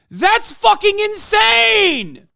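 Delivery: incredulous furious yell; outraged yell. Real, not acted. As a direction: stretch out the outrage. Outraged streamer.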